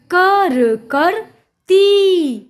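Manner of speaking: कर, करती sounded out very slowly in pieces; syllable by syllable. Shown as a demonstration. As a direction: stretch each sound of कर, करती with gaps. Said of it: "जोड़कर पढ़ेंगे".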